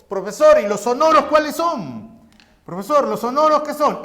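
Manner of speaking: lightly voiced student piping up with a question; student asking. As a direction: ask like a pupil interrupting.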